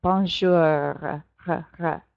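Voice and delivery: low "bonjour", then "R" rasped in the throat; French throaty R. Shown as a demonstration. Teacher drills the class on R, throaty French sound. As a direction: growl the R of "bonjour" and repeat "R". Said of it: "Try your R and your bonjour".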